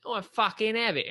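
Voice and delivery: put-on high, Cockney-style Kent accent. Mimicking a university friend from Kent, high Cockney-style accent. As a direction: imitate a broad Kent lad. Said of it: "he was from Kent".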